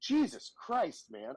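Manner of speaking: retold shock, high and drawn out; stretched-out, high-pitched disbelief; astonished exclamation. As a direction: react as if your hand is being crushed. Reacting to a crushing handshake.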